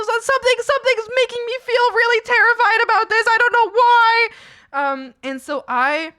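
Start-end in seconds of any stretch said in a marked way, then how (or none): 0.0-4.3 panicked inner voice. Retold or imitated. Retold terror, gut feeling.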